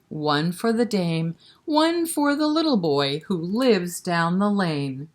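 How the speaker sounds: loud high nursery rhyme call; rhyme recital. Acted. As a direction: belt out the bleating opening.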